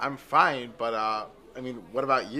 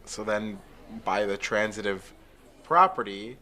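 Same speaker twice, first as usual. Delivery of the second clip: normal talking voice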